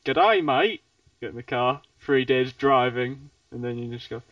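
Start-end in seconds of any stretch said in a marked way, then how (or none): 0.1-0.8 loud mock Aussie greeting; Australian accent. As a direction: hail someone in broad Australian. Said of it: "Whereas in Australia".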